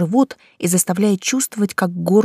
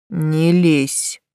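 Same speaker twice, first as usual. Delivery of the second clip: gritted; 2 semitones lower than usual. A narrator's reading. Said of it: "процедил Ариен".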